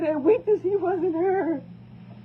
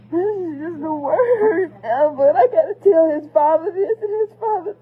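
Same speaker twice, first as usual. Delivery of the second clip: crying; 3 semitones higher, 7 dB louder than usual. Real, not acted. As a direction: cry it out, barely holding on. Crying about telling the father.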